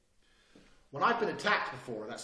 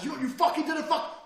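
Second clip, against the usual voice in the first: loud, very high outburst; crazy screaming; 12 semitones higher, 6 dB louder than usual. Shown as a demonstration. Man demonstrating a psychopathic switch, screaming. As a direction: blow up suddenly in a yell. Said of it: "going from crazy screaming to nice".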